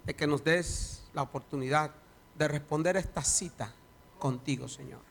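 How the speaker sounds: prayer swelling to loud preaching; fervent prayer. Acted. Pastor praying aloud over the congregation, fervent prayer. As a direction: pray with growing fervour.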